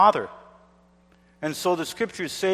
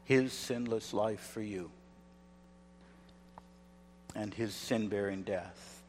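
hushed; 6 semitones lower, 16 dB quieter than usual. Acted. Quiet appeal before preaching.

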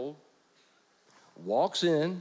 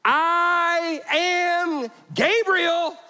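high, loud, drawn-out angelic yell; 13 semitones higher, 6 dB louder, much slower than usual. Acted. Gabriel yelling at doubting Zechariah, loud and slow. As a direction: boom out the name like an angry angel. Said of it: "Notice the exclamation point".

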